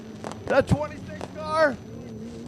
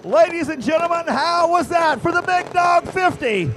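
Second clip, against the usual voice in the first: booming pumped announcer; 5 semitones higher, 3 dB louder than usual. Acted. Announcer addressing the crowd.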